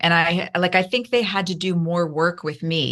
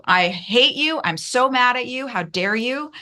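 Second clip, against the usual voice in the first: raging at God; 7 semitones higher than usual. Retold or imitated.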